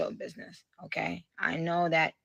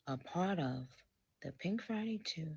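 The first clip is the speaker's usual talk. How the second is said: 1 whisper; 4 semitones lower, 10 dB quieter than usual. Real, not acted.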